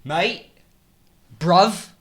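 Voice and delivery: luide, hoge Britse uitroep; Brits accent. Acted. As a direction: roep het in plat Brits accent. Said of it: "now I just do it like how they actually say it".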